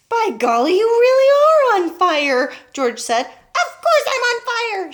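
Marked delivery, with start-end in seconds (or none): 0.1-2.5 high, loud, drawn-out amazement; amazed shout. A narrator's reading. Boy seeing smoke pour from grandma, amazed shout. 3.3-4.9 high, loud, drawn-out yell; indignant yell. A narrator's reading. Burning grandma answering the boy, indignant yell. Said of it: "she yelled".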